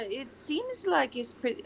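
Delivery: accidental French-tinged character voice; French accent. Acted. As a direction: let a French accent creep in. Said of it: "I'm slipping into French".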